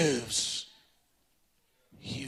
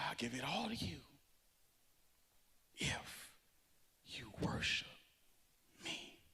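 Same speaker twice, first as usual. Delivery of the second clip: breathy near-whisper, much softer; hushed tempter; 6 semitones lower, 23 dB quieter than usual. Acted. Preacher voicing the devil's offer in a soft hush. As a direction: lure them in a low hush.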